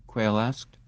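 usual speaking voice

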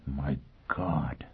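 hushed, voiceless shock; whisper. A narrator's reading. Man seeing his marked face in the mirror, whispered. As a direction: whisper it, barely audible. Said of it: "he whispered".

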